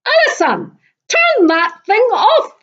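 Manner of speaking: loud, high furious hiss. A narrator's reading. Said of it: "hissed Kate".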